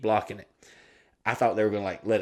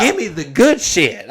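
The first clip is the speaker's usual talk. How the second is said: high, loud and drawn out; mock demand; 7 semitones higher, 10 dB louder than usual. Customer to bartender at a free bar, exuberant mock demand. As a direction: belt out a comic demand.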